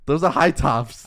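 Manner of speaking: slow, loud, each word pressed; loud insistence. Real, not acted. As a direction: insist firmly, almost shouting. Insisting after friend keeps arguing.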